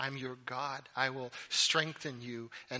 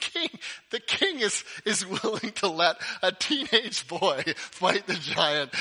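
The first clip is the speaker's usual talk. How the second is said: high, loud, laughing disbelief; words breaking up with laughter; 5 semitones higher, 8 dB louder than usual. Real, not acted. Pastor amused that the king lets a teenager fight, laughing.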